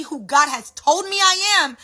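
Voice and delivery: ordinary talk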